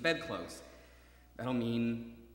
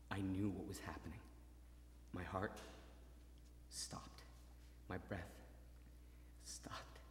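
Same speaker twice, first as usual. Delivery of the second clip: 2 hushed, barely voiced; 3 whisper as if afraid to break it; 4 awed, holding his breath; 1 breathless whisper; 6 semitones lower, 16 dB quieter than usual. Breathless moment of realization.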